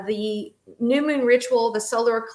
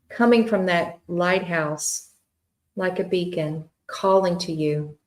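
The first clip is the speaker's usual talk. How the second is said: guided meditation; 2 semitones lower than usual. Acted. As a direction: ease listeners gently into relaxation.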